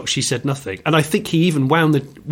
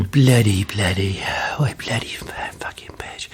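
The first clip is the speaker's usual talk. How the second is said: muttering curses; 4 semitones lower than usual. Retold or imitated. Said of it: "He was starting to mutter under his breath".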